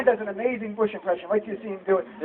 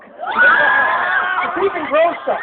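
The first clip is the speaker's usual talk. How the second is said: laughing shout; 6 semitones higher, much slower than usual. Real, not acted. Joke about [name].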